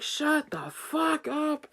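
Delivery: very high, slow, strained yell; imitated angry scream. Grown-ass man shutting down the kid, imitated scream. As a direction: stretch out a mock scream of outrage. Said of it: "this guy starts screaming".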